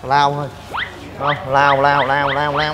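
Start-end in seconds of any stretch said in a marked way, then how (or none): none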